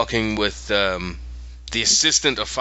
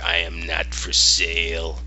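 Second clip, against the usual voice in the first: low, slow bear voice; Yorick; 6 semitones lower than usual. Retold or imitated. Bear turns down girl's plea, low and slow. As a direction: drop low and refuse slowly, word by word. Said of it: "And he's like".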